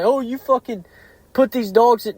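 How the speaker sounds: whiny accusing voice, mocking them; mocking critics. Mocking critics of hog dogs.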